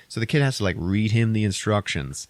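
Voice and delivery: usual speaking voice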